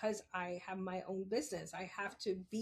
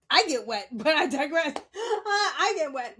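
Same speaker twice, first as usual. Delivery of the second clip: loud cackling aside; real laughter, words pushed out loud; laughing; 6 semitones higher, 12 dB louder than usual. Real, not acted. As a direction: crack up at your own dirty joke. Laughs at her own double meaning.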